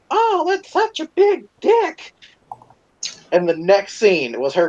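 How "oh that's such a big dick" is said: high, drawn-out mock female voice; mock porn actress. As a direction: put on a much higher voice, slowly imitating her. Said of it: "and she goes".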